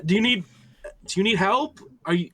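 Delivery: high, loud offer of help; calling out. Acted. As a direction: call after a fleeing stranger. Character calls after fleeing stranger.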